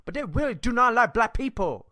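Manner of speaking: a high, loud, hurried mock Korean accent; a jokey, put-on Korean accent. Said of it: "they talk like this".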